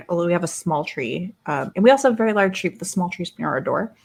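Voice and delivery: ordinary talk, nothing marked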